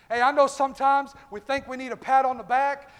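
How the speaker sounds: ordinary talk, nothing marked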